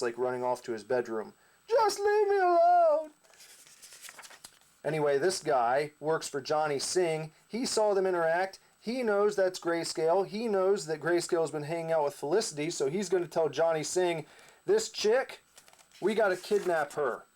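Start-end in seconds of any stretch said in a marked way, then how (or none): 1.7-3.1 hammed-up teen wail, much higher and louder, slow; a teen storming off to his bedroom; sulky teenager. Teen angry at his parents running to his bedroom, hammed-up high wail. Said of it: "teeny bopper who's angry at his parents". 14.7-17.2 henchman. Acted. Henchman voiced telling boss plan.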